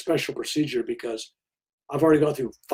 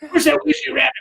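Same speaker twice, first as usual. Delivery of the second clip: high, half-crying cartoon lisp; a rough, teary go at a cartoon hunter; imitating a lisping cartoon hunter; Elmer Fudd; 10 semitones higher, 4 dB louder than usual. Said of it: "I sounded like, uh, Elmer Fudd".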